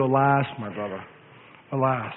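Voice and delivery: very low, soft funeral lament; mournful lament. Retold or imitated. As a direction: intone it like a mourner over a grave. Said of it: "nobody will be saying over you".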